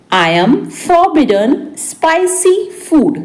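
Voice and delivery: word by word for learners; spaced-out repeat. Shown as a demonstration. Teacher repeats translation word by word. (रुक-रुककर, शब्द-दर-शब्द)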